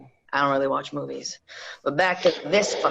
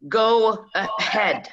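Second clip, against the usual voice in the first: slow, higher and louder command; slow menacing order; 4 semitones higher, 4 dB louder, much slower than usual. Acted. Reggie ordering Lincoln to shoot, slow and menacing. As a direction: drag it out as a dare.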